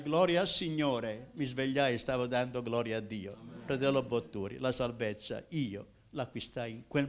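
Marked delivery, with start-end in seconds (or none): 0.0-1.3 louder, joyful cry of glory; shouted praise. Retold or imitated. Dreamer shouting praise to God at his exam, louder. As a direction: call it out in triumph.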